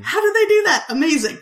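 high, loud burst of wonder; amazed. Real, not acted. Film fan marvelling at the shark effect, amazed. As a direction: marvel at it, pitch leaping up.

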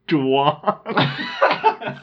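breaks up laughing mid-sentence; can't keep a straight face, laughs out; real helpless laugh; burst of laughter. Real, not acted. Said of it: "hard to say that with a straight face".

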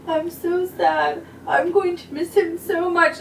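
half-hearted sob-story voice; mock crying girl. Teacher imitating the student leaving her boyfriend, mock crying. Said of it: "she came out crying".

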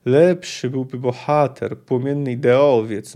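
slow, drawn-out syllables; drawling. Acted. Leuwarden drawls his dislike of the plan, slowly. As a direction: drag each syllable out in distaste. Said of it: "sprawiła, że przeciągał jeszcze bardziej".

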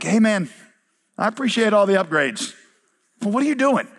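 politely baffled, rising in pitch on the question; puzzled manager. Pretend. Hotel manager to an over-renovating guest, mildly baffled.

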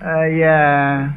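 ordinary talk, nothing marked

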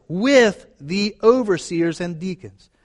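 with in with the overseers drawn out slowly and pressed; slow down and hit with; stress on with. Shown as a demonstration. Preacher telling the congregation to underline this word, stressing with. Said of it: "underline that word".

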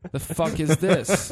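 incredulous laugh at the movie; half-laughing what-is-this; laughing disbelief. Real, not acted. Retelling baffled reaction to movie.

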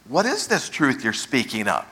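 genuinely inquiring, understated; sincere inquiry. Shown as a demonstration. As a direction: ask it as if you truly want to know. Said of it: "not a sincere inquiry? He's not saying".

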